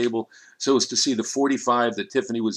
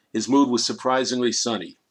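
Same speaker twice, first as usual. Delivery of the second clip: ordinary delivery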